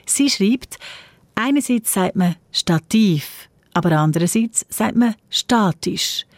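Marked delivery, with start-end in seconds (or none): 2.5-3.5 Stativ stressed on its last syllable, -TIV; stress on second syllable. Shown as a demonstration. Radio host quoting a listener's question, Stativ stressed on -tiv. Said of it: "Stativ auf der zweiten Silbe". 5.3-6.3 statisch stressed on STA-; stress on first syllable. Shown as a demonstration. Radio host quoting a listener's question, statisch stressed on STA-. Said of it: "statisch auf der ersten".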